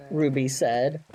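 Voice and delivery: put-on animated reading to children; lively fairy tale voice; storybook narration. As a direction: tell it like a bedtime tale. Tells Chicken Little story.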